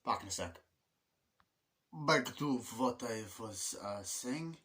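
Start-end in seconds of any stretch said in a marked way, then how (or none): 1.9-4.6 slow, oddly drawn-out delivery; odd put-on voice. Real, not acted. Resuming the review after a break, oddly slow. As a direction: stretch the words out in a silly voice. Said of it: "Don't know why I said it like that".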